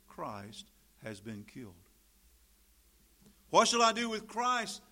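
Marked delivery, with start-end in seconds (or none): 3.5-4.8 high, ringing preacher's cry; loud dramatic question. Acted. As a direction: call it out to a crowd as Pilate. Acts out Pilate addressing crowd.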